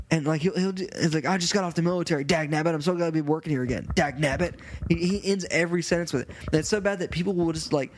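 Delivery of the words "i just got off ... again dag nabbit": ordinary delivery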